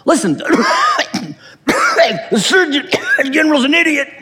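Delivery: a chain smoker wheezing out his defense; mock sick smoker, laughing through it; coughing smoker. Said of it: "He says".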